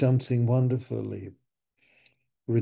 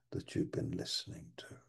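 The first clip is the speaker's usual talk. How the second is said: hushed aside; whisper; 6 semitones lower, 11 dB quieter, faster than usual. Acted. Whispering to the listeners. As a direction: whisper it close.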